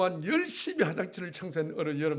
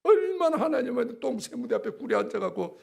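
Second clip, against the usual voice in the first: impassioned preaching; 7 semitones higher, 5 dB louder than usual. Real, not acted. Preaching at full force.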